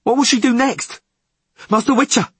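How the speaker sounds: high, loud, panicked question; frantic yell. A narrator's reading. Skipper appealing to the witcher, high frantic yell. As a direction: yell to him for answers. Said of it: "yelled Podlorak".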